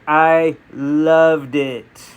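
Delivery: very slow, separated "I ... loved it"; drawn-out emphasis. Real, not acted. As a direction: stretch "loved" right out, word by word. Repeats it slower for emphasis.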